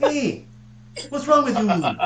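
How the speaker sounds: very high caricature Canadian voice; high-pitched Saskatchewan accent. Acted. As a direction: play a squeaky, put-out Saskatchewan Canadian. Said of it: "They're so high-pitched".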